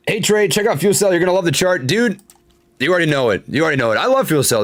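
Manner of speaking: normal talking voice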